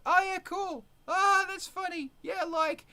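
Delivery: airy high voice, mock shallow reaction; high mock bored viewer. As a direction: toss it off like a bored scroller. Mocking casual TikTok viewer.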